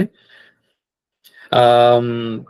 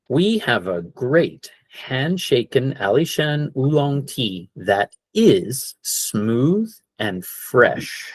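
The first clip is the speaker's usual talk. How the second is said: slow demo sentence; 3 semitones higher, slower than usual. Shown as a demonstration. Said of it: "Missed a little word in there".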